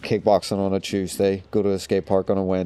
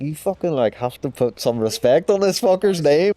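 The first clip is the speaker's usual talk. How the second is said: higher-pitched indignant telling-off; mock outrage, pitched up; retold indignation; 6 semitones higher than usual. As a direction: put them in their place, half in jest.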